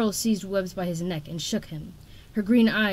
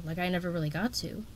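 plain reading voice

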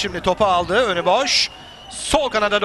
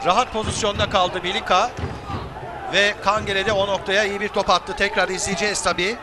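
Turkish football commentator; 3 semitones higher than usual. Acted.

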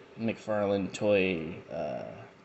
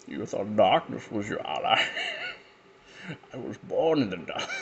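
Bane voice; 4 dB quieter than usual. Retold or imitated. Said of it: "I can't do that voice without laughing".

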